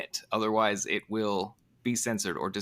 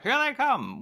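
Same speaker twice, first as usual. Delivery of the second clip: 1920s radio announcer; 12 semitones higher than usual. Shown as a demonstration. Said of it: "nineteen twenties, like".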